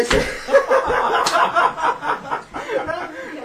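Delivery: loud high burst of laughter; a loud laughing fit; big laugh. Real, not acted. Laughing at the drunk's reply.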